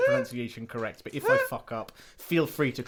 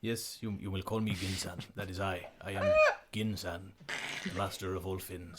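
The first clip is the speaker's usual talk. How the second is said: Gin voice; 5 semitones lower, 7 dB quieter than usual. Acted. GM voicing Gin the fish.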